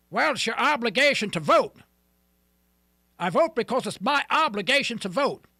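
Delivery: mock voter. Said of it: "You know?".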